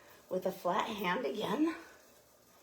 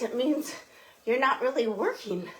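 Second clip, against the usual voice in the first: ordinary talk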